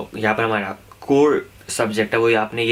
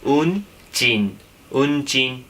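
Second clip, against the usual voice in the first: slow drill word; 3 semitones higher, much slower than usual. Shown as a demonstration. Teacher drilling students on a new word, slow and careful. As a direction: stretch उनचिन out very slowly, syllable by syllable. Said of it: "उसको हम बोलते हैं".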